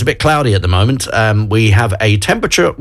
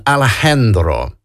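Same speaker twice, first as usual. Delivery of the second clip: put-on Spanish accent. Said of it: "I can't say that word without doing a Spanish accent".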